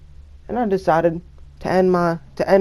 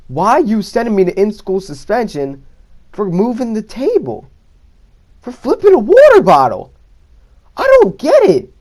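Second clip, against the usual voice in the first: building from higher to much higher and louder, retold; rising indignant protest; 5 semitones higher, 5 dB louder than usual. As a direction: wind up into a shrill-high, loud complaint. Protesting to security guard.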